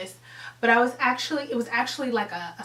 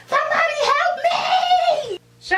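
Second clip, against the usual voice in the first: high-pitched loud reenacted shriek; screaming for help; 7 dB louder, much faster than usual. Playing out how the push occurred, screaming. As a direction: play it out at a full scream. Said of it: "as I was screaming".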